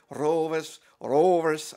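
imitated odd pronunciation of "Rovers"; JDT. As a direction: say "Rovers" weirdly, as an accent impression. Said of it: "He says it weirdly".

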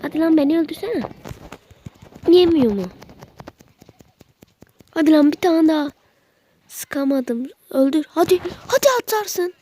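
high, loud frantic urging; excited yelling. Real, not acted. Gamer yelling at enemies in final fight, frantic. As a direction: yell at the screen, fast.

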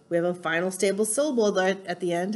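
ordinary talk, nothing marked

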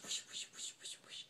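whispered whooshes. Retold or imitated. Imitating tail swishing.

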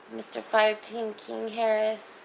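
usual speaking voice

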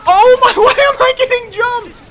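very high, loud laughing shriek. Real, not acted. Streamer getting jumped again, laughing shriek.

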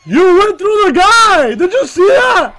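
loud high outraged yell; raging gamer. Real, not acted. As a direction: shout at the broken game. Outrage at game glitch.

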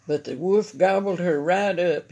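ordinary delivery

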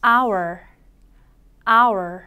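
"hour" said as ah plus our, a curled R at the end; 따라 하도록 보여주는 hour; model hour. Shown as a demonstration. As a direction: model "hour" twice for the class. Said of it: "한 번 더".

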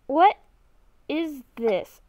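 normal talking voice

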